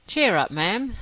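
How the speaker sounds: loud, high, put-on heartiness; assumed hearty. A narrator's reading. Frere cheers the ailing lady, assumed heartiness. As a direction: lift it brightly with forced cheer. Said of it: "with an assumption of heartiness".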